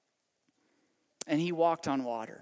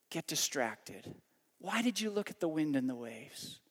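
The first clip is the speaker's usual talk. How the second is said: gentle lowered rebuke. Acted. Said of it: "And then Jesus said".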